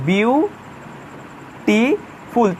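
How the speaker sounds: বিউ, টি, ফুল said as three separate beats with pauses between; syllable by syllable. Shown as a demonstration. Teacher splitting beautiful into syllables for students. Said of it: "ভেঙে ভেঙে উচ্চারণ করতে হচ্ছে".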